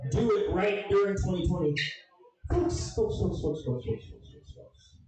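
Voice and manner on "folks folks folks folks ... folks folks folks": fading out. Pretend. Comic calling the crowd, fading to a whisper. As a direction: let it die away. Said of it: "fading repeated address".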